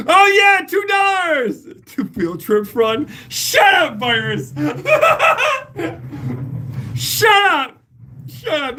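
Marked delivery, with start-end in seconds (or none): 0.1-1.7 high, loud delighted cry; thrilled at a tiny tip, loud and high; excited shout. Real, not acted. Reacting to a tip. 3.3-8.8 laughing shout. Real, not acted. Laughing at a viewer's joke.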